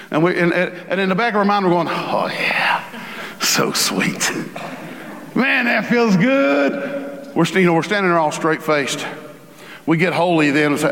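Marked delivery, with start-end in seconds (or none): 1.9-7.3 a smug secret whisper to yourself, drawn out at the end; gloating inner whisper. Retold or imitated. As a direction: whisper it, savouring it. Smug inner voice while forgiving.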